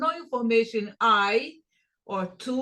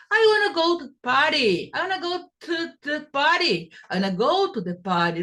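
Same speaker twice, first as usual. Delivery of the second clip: fast and higher, the words run together; fast connected speech; 5 semitones higher, much faster than usual. Shown as a demonstration. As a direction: rattle it off at speed. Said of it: "kind of velocity".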